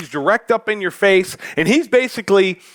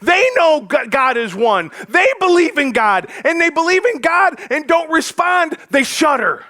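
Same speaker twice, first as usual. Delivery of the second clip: shaming sarcasm; 9 semitones higher than usual. Pretend. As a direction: shame them with sarcasm. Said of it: "he's basically like".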